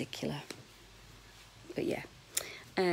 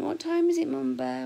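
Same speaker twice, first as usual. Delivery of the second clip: put-on child's question; 9 semitones higher, 5 dB louder than usual. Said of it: "He went".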